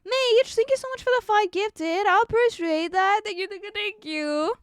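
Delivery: high, bright-eyed excited gratitude; excited thanks. Real, not acted. Streamer thanking a viewer for gifted subs, excited. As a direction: gush thanks to a generous viewer.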